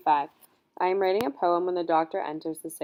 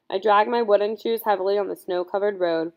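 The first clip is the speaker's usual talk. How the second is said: usual speaking voice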